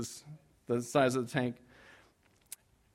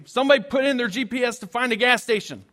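loud, high, frantic plea to the car; 7 semitones higher, 7 dB louder than usual. Retold or imitated. Pastor retelling his frantic plea to his family in the car. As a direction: urgently demand someone find gas. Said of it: "I'm like, frantically".

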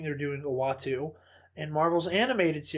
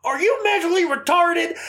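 much higher and louder mock yell; imitated insulting yell; 13 semitones higher, 8 dB louder than usual. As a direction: fire the insult at the fan. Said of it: "he does the whole".

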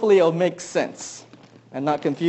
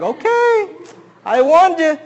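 told-you-so dad; 9 semitones higher, 4 dB louder than usual. Retold or imitated. Dad warning the reaching baby.

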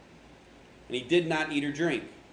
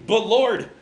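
loud, high protest; exclaiming; 6 semitones higher, 8 dB louder than usual. A narrator's reading. Ananias objects to the Lord's order, exclaiming. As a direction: burst out in objection, louder and higher. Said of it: "exclaimed Ananias".

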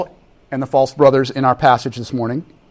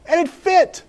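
triumphant reveal; 13 semitones higher, slower than usual. Acted. Triumphant story climax.

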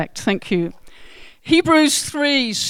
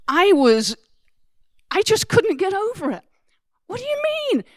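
much higher, incredulous; astonished; 11 semitones higher, 3 dB louder than usual. Real, not acted. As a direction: sound disbelieving. Preacher astonished at unkept promise.

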